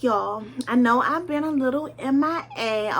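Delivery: ordinary talk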